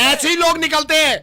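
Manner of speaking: high, loud phone-row shout; angry shouting. Acted. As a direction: yell in an angry argument. Phone पर गुस्से का झगड़ा. (गुस्से में ऊँची चीख)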